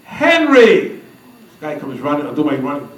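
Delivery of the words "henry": high-pitched mock yell for a servant; retold yell. Mary summoning Henry in mid-conversation, mock yell. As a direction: imitate a woman calling out across a room. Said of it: "she yells out".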